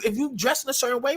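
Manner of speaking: ordinary delivery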